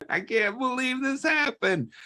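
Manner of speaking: high giggly mock disbelief; acted laughing. Acting out Eddie chuckling at the bear, high laughing voice. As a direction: pitch it way up, as if amused. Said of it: "he's over there laughing. He's like".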